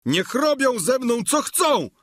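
high-pitched wail of protest; frantic, outraged hollering; Sancho bellowing. A narrator's reading. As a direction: bellow like a bull, pitched far up. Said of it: "zaczął jak byk ryczeć".

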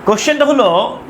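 ordinary talk

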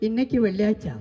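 hushed, lightly voiced possessed woman; a soft touch of film menace; Nagavalli. [name]'s skit for the crowd, lightly voiced Nagavalli. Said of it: "നാഗവല്ലീനാണോ ഇഷ്ടം".

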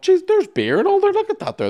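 gawp at the free beer in delight; excited thought. Retold or imitated. Traveller marvels at free lounge beer, high amazed voice. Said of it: "And then I thought".

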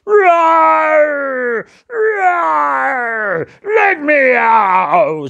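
very high, loud desperate roars and shout; trapped lion shouting. Acted. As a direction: cry out to be freed.

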